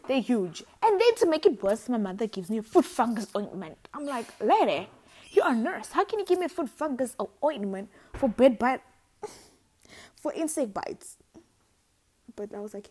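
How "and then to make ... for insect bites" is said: high, loud outrage; indignant. Retold or imitated. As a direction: protest in disbelief. Indignant at mother.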